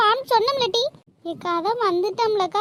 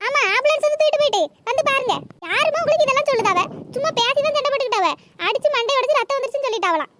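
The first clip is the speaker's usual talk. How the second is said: fast, indignant retort; angry; 2 semitones higher, faster than usual. Acted. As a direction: rattle off an angry denial. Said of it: "எங்க கிட்ட கோவப்படுதே". (கோபமாக வேகமாகப் பொரிந்து தள்ளும் குரல்)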